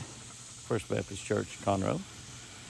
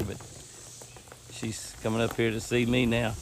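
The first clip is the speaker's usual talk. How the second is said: ordinary talk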